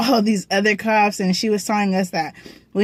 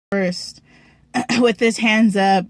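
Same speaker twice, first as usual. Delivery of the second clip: ordinary delivery, nothing marked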